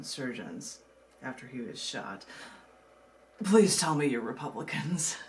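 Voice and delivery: usual speaking voice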